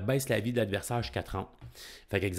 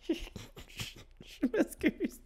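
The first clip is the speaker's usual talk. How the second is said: laughing apology; 7 dB quieter, much slower than usual. Real, not acted. Giggling after mangling a name.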